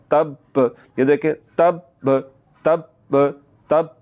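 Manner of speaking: "तब" as "tab", a held stop, then "ba"; held doubled b. Shown as a demonstration. Teacher shows shadda, breath held on the b of तब. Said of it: "सांस को थोड़ा सा रोकना".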